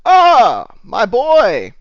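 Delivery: loud, high, drawn-out hail; booming greeting. Acted. Barkeep greets a patron walking up, booming hail. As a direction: cry out a long, delighted welcome.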